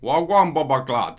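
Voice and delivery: put-on loud Jamaican accent shout. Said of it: "a Jamaican accent".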